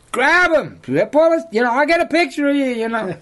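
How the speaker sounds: high, loud egging-on call; excited urging shout. Retold or imitated. Guide hollering up at the hunter in the tree. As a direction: egg him on from below the tree.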